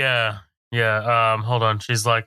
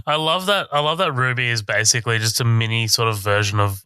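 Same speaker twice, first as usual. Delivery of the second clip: normal talking voice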